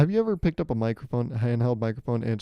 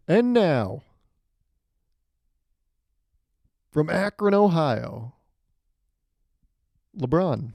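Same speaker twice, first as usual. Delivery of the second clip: big booming arena intro, higher and louder; arena announcer; 4 semitones higher, 4 dB louder, slower than usual. Pretend. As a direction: stretch it out like a stadium announcer. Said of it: "when they make the big announcement".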